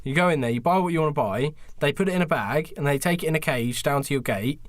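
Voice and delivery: ordinary talk, nothing marked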